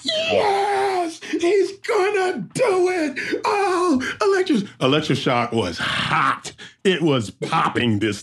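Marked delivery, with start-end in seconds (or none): 0.0-4.7 much higher, slow cheering yell; crowd shouting. Retold or imitated. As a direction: yell it like the crowd urging him on. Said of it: "they're like".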